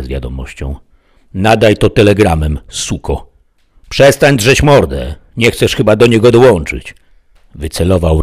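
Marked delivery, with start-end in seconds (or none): 1.3-3.3 louder, higher command; rough order. A narrator's reading. Killer orders the woman to send it, rough command. 3.9-6.9 loud, high, slowed menace; angry threat. A narrator's reading. Killer threatens the screaming woman, angry loud order.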